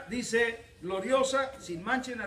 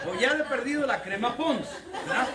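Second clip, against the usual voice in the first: laughing joke; 6 semitones higher, 4 dB louder than usual. Acted. Husband joke, laughing and louder.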